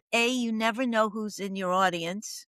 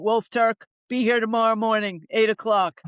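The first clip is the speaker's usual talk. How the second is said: louder, curt official summons; a hint of a stern probation officer, louder; 5 dB louder than usual. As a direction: bark it like a parole officer giving orders. Probation officer's order quoted.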